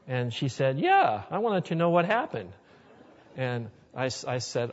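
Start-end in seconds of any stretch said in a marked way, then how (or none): 0.8-2.5 eager admission. Retold or imitated. Sister admits spying, retold.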